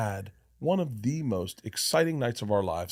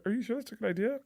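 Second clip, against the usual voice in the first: little brain; 10 semitones higher, 7 dB quieter, faster than usual. Retold or imitated. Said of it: "And he's like".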